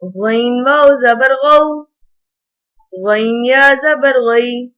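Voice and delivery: gentle, even Qaida recital, no stretching or jerking; patient teacher's drill reading. Acted.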